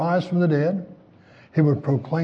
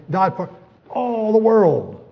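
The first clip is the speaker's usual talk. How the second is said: emphatic slow proclamation; 5 semitones higher, 4 dB louder, slower than usual. Acted. Preacher emphatic climax.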